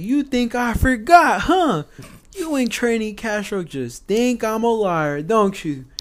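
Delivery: slow, loud drill sergeant accusation; imitating an angry drill instructor. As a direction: lean in on them like a furious drill sergeant.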